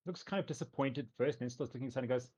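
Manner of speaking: normal talking voice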